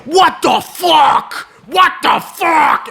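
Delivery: drawn-out, high-pitched shout of disbelief; going crazy. Retold or imitated. Cokehead hearing the man is six-foot-nine, going crazy. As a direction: imitate a freak-out, stretching each word in a high shout. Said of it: "starts going fucking crazy".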